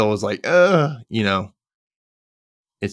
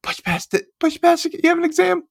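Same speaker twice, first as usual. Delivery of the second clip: mock pep talk. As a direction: coach yourself not to cry. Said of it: "Holding back tears".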